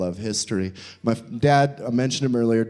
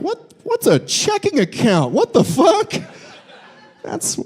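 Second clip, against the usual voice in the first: high, squeaky bewildered voice; baffled juggalo teen; 11 semitones higher than usual. Acted. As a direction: play a lost teen seeing the modern world.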